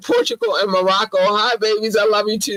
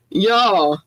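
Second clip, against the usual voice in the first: ordinary talk, nothing marked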